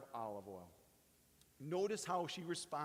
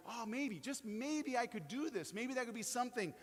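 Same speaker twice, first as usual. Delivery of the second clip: higher, quicker hopeful voice; a little thrill of a new idea; excited inside; 7 semitones higher than usual. Retold or imitated. Preacher acting out a believer's sudden hope, excited and rushed. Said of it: "we start to get a little excited inside and we go".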